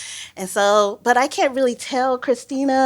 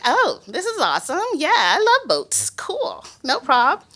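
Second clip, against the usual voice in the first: lightly acted high-pitched enthusiasm; eager agreement; 7 semitones higher than usual. Telling of agreeing to see the reefs, eager agreement. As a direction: play up being keen, pitch jumping high. Retelling keen reply to boss.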